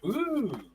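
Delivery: high-pitched, soft "ooh"; long, high coo of wonder, kept soft; a drawn-out ooh, high and light; retold ooh. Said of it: "looked at the kit and went".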